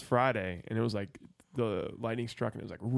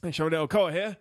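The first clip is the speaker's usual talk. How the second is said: put-on Cockney accent; 5 semitones higher than usual. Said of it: "why you guys have a Cockney accent".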